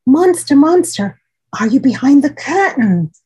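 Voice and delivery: high, loud call into the dark room. Acted. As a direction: call out for the monster. Child calling monster.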